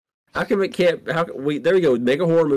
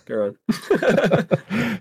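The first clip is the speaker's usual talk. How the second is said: very slow and higher quoted carry on; drawn-out quote; 8 semitones higher, much slower than usual. Retold or imitated. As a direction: stretch it out like a movie line. Quoting a movie line.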